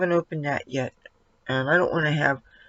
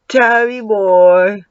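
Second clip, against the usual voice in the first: drawn-out call; 2 semitones higher, 7 dB louder, much slower than usual. Real, not acted. Calling cat's name.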